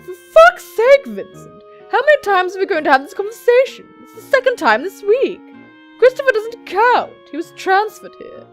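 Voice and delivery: loud, high, worked-up quarrelling; heated, high-pitched exasperation; exasperated argument. Acted. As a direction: argue back loudly, fed up. Acted quarrel between boss and Vincent.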